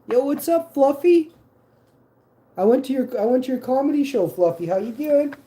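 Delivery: high, loud hyped fan greeting. Pretend. Greeting an imagined comedian.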